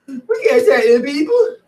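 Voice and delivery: hammed-up whimpering weakling; whiny weakling. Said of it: "Listen to the rest of the weaklings".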